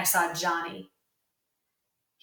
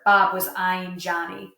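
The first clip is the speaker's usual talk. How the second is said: ordinary delivery, nothing marked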